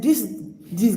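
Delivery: ordinary delivery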